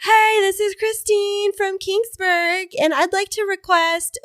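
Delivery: put-on high, slow, eager kid caller voice; young radio caller. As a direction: call in to the radio like a shy middle schooler. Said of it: "just to be like".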